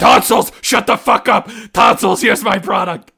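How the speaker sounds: loud, high-pitched bellow; mock angry shout. Pitcher cuts off fellow panelist to present product, mock shouting. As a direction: shout over them in mock outrage.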